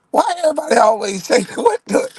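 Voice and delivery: high, disbelieving squeak; baffled at the compliment; incredulous. Real, not acted. Incredulous reaction.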